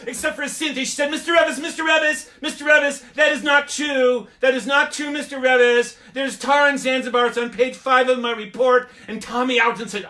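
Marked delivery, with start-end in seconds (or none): 1.1-8.9 high, loud insistent cry; shrieking protest. Retold or imitated. Cynthia to the teacher, a loud high protest. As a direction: tattle indignantly to the teacher.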